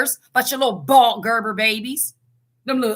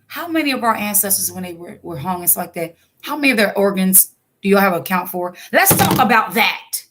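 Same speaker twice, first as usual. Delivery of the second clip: ordinary talk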